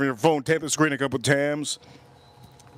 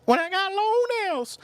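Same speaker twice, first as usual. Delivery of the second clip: high-pitched mock woman's voice; stripper imitation. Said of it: "she goes".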